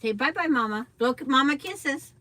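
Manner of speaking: high sing-song coaxing. Real, not acted. Grandparent coaxing toddler to wave.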